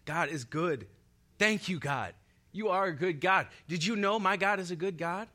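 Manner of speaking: from bright to high and loud, growing excitement; preacher showing how to tell people, swelling with joy; building joy. Shown as a demonstration. Preacher models joyful witness to others.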